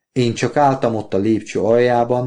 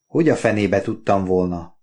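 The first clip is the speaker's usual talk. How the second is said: usual speaking voice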